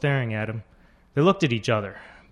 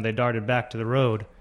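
plain reading voice

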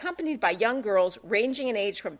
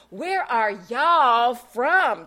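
slow, louder mock Southern drawl; 5 dB louder, much slower than usual. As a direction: mimic a Southern belle drawl. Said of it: "mimicking the girl's Dixie Belle on Miller Lite drawl".

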